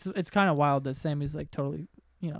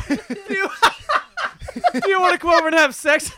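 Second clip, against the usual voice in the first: laughing; 12 semitones higher, 10 dB louder than usual. Retold or imitated. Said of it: "She never said that".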